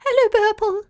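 a swarm of little cloud voices, very high; thousand tiny voices. Acted. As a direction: squeak it like a flock of tiny clouds. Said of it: "replied in a thousand tiny voices".

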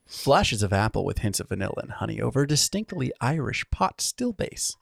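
soft, mock-fancy tasting-note read. Shown as a demonstration. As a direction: read the label like a sommelier. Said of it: "let's see. nose".

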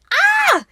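high, loud fangirl scream; excited scream. Real, not acted. Fan reacting to a glimpse of his chest, excited scream. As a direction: let out a piercing squeal of delight.

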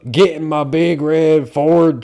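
high, loud and slow Southern drawl. Retold or imitated. Girls pranking Scottish guys, loud mock Southern accent. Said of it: "like, spot on".

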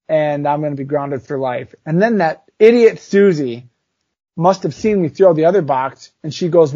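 ordinary talk

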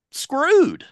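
high, loud comic dismay; retold despairing outburst. Stranded caller realizing he is stuck, high loud dismay.

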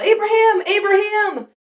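high, loud urgent call of the angel; shouting. Acted. The angel shouts to Abraham at the altar, loud and high. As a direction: shout his name to stop him. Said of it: "the angel of the Lord shouts out".

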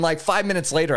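usual speaking voice

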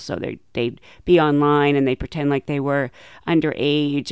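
usual speaking voice

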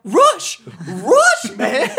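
very high, loud frantic shouting; yelling the answer at the podcast host, frantic; excited yelling. Retold or imitated. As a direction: scream the answer like you can't believe they missed it.

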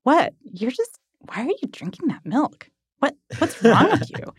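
a mild mock sceptical Zoomer; a hint of a cow baffled by milk; skeptical Zoomer cow. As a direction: lightly imitate a disbelieving Zoomer cow. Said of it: "the cow was just, like, some kind of Zoomer".

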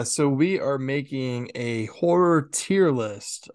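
ordinary talk, nothing marked

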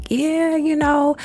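high, drawn-out happy yeah; giddy, oblivious child. Retold or imitated. Child confirming teacher's words to mom, high and oblivious. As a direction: imitate a kid cheerfully missing the point. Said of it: "I'm all giddy and happy".

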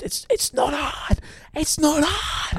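high, slow mock whine; high squeaky voice. Mock frustration at listeners.

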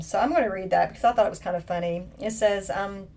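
usual speaking voice

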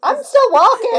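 high, loud character voice. Acted. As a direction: speak as Fidela. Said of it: "Is that Fidela's voice".

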